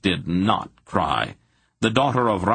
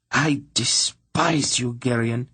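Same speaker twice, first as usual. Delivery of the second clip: higher, slow, intense and seething; intense whisper; 5 semitones higher, slower than usual. A narrator's reading. Princess telling Geryon she despises him, intense. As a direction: spit it out slowly with contempt. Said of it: "she whispered at him with a terrible intensity".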